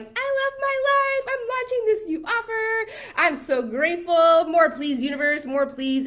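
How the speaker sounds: high, loud, gushing glee; gushing excitement. Retold or imitated. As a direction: gush like everything is perfect. Gushing quote of happy self.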